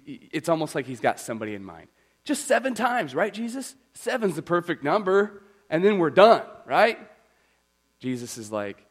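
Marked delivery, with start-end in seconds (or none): 2.3-7.0 high, loud hammed-up Peter pleading his case; Peter bargaining. Peter haggling with Jesus over forgiveness, high and loud. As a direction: push Jesus for a limit like an eager fisherman. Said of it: "big, gruff fisherman, getting a little upset".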